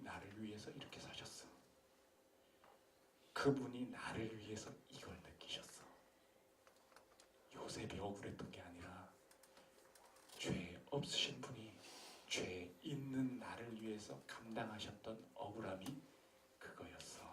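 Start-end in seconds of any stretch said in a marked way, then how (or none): 3.3-9.1 그분이 whispered, breath only, falling. Shown as a demonstration. Pastor showing how to read scripture, intimate whisper. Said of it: "이렇게 읽는 거예요". 10.9-17.2 soft breathy whisper; whispered realization. Acted. Pastor voicing a believer's realization, hushed whisper.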